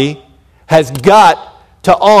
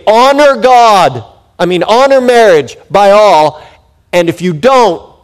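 paraphrased command; 5 semitones higher, 6 dB louder than usual. Retold or imitated. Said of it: "it's like he's saying".

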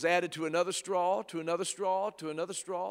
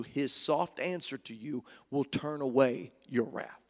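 ordinary delivery

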